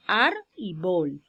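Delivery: "ar" and "bol" slowly separated; split into syllables. Shown as a demonstration. Teacher dividing árbol for students, slow syllable-by-syllable "ar y bol". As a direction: sound out "ar y bol" as two drawn-out syllables. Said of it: "Árbol tiene dos sílabas".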